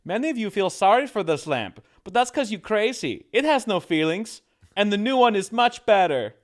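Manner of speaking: high, loud Swedish accent impression. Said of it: "he's got a Swedish accent".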